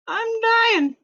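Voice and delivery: very high, mock-dramatic baby bear; hammed-up tiny cry. A narrator's reading. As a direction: wail it like a tiny cub. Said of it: "cried Baby Bear".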